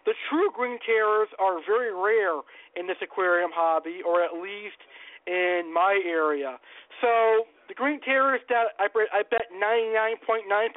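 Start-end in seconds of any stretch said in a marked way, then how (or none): none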